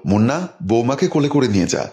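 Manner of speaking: ordinary delivery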